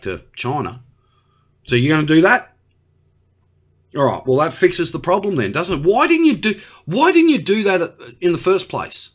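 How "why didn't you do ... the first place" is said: high, insistent questioning; exasperated scolding. Acted. Australian scolding Xi for the delay, exasperated. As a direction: demand it with pitch jumping up. Scolding Xi for not acting sooner.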